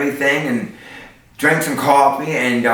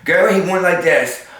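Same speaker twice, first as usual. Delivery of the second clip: normal talking voice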